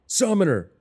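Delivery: high, loud scream for help; piercing radio distress call. A narrator's reading. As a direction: yell the call sign desperately. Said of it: "Brenda screams into the radio".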